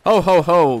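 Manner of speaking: loud jolly Santa ho ho ho; Santa laugh. Acted. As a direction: boom it out like Santa Claus. Christmas greeting opening the vlog.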